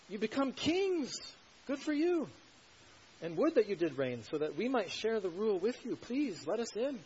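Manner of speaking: high, loud mock pleading and congratulation; sarcastic. Preacher voicing Paul begging to share Corinthians' rule, sarcastic. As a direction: grovel with exaggerated fake admiration. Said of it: "Paul's sarcasm is to try to".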